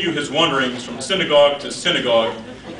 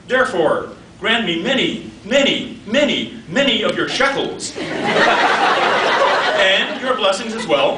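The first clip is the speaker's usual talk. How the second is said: higher-pitched mock plea, hammering each repeat; pleading daughter; 5 semitones higher, slower than usual. Daughter begging father for shekels, higher hammed-up plea. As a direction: beg playfully, punching every "many".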